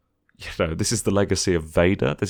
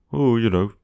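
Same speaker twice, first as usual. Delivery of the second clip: mock nitpicking fan; 5 semitones higher, 3 dB louder than usual. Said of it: "you can go".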